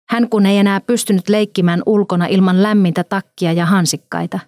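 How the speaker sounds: ordinary delivery, nothing marked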